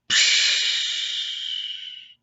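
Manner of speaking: long breathy shocked gasp, very high; loud gasp. Acted. Dex sprayed by skunk.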